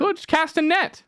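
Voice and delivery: high, silly villager voice; comic mock-Irish peasant. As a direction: pitch it up for a simple suggestion. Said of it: "Not one of them was like".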